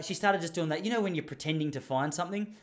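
ordinary delivery

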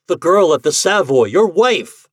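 high, loud, emphatic insistence; protest. A narrator's reading. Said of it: "protested Ford".

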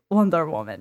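low, gently insistent charmer; lightly voiced, low and unbothered; imitated island-breeze voice. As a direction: answer low and easy, as if obvious. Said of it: "a voice that sounds like an island breeze".

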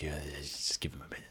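hushed whisper. Acted. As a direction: whisper it on the side. Nara pops her head out.

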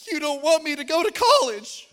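put-on high, loud wailing child's cry. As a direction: wail it like a heartbroken seven-year-old. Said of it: "he comes back out and he's crying".

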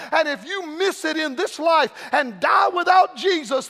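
urgent pulpit plea, building in force; impassioned preaching. Acted. Preacher pleading mercy to the congregation, impassioned. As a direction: preach it hard, stretching the key phrases.

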